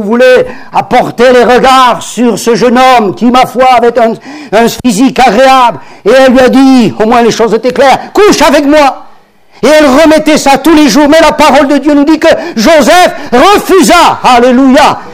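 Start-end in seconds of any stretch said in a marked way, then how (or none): 6.0-15.0 high, loud fervent preaching; preaching at full force. Acted. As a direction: lift the congregation, build to Alléluia.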